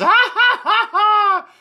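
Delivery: high, loud put-on cackle; mock laughter. Describing the drawn mouth, mock high cackle. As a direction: laugh out high and stagey on held notes. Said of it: "the mouth is".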